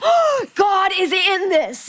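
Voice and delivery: high, drawn-out exclamation; slow, high, excited cry; excited realisation. Retold or imitated. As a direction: stretch it out in thrilled revelation. Acts out awed, excited realisation.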